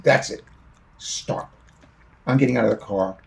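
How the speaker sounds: fed-up outburst. Retold or imitated. Son storms out of car.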